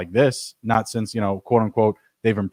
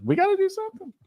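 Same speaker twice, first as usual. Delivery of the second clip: squeaky falsetto plea; put-on high-pitched desperate voice; mock desperation; 3 dB quieter, faster than usual. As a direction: jump way up high, as if pleading. Acted panic over injured player.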